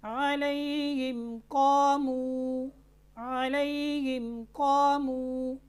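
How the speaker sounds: अलैहिम कामू with the मीम clearly pronounced; clear meem. Shown as a demonstration. Teacher demonstrating the clear meem. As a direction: close the lips firmly on the मीम before कामू. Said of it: "देखें कैसे ज़ाहिर करके पढ़ रहा हूँ".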